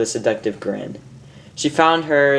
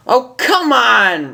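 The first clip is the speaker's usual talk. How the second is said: higher, drawn-out whine, much slower; protesting Rainbow; 10 semitones higher, 4 dB louder, much slower than usual. Acted. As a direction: drag it out in protest. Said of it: "Rainbow protested".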